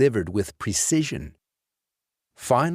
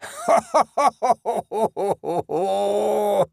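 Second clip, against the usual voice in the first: high, drawn-out gloating cackle; pleased ghostly laugh; 11 semitones higher, 3 dB louder than usual. A narrator's reading. Dead man laughs over Lance's swollen eye, gloating. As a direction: laugh like a delighted ghoul. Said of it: "Clancy let out a pleased laugh".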